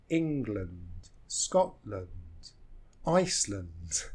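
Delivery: "England, Scotland, Iceland", each ending in a weak "lund"; low, measured list with "land" as "lund"; reduced second syllable. Shown as a demonstration. Teacher giving examples, reduced second syllable. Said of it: "that second syllable is reduced".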